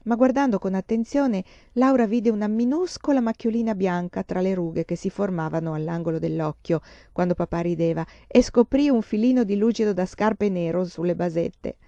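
usual speaking voice

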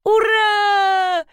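very high, drawn-out triumphant whoop; delighted cheer. A narrator's reading. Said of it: "пришла в восторг Ирина Леонидовна". (восторженный протяжный крик)

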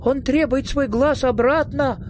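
high-pitched alarmed cry; mild acted shout of a thin little man. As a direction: call out a warning, pitched well up. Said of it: "закричал худой человечек".

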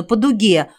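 plain reading voice

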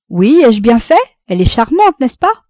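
excited and hopeful; eager. A narrator's reading. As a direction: bubble over, rising to a high final question. Son eagerly seeks mother's approval.

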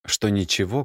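ordinary delivery

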